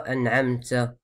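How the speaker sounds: "an'amta" ending in a hissed "tsa"; Moroccan-style "an'amtsa"; airy T. Shown as a demonstration. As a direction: let air escape on the t of "an'amta". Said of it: "C'est pas".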